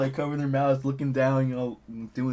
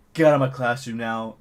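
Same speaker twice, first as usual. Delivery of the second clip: firm, slightly louder command, no scream; retold firm order; 3 semitones lower, 4 dB louder than usual. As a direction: order them out, imitating a teacher. Upset teacher ordering them out, first take.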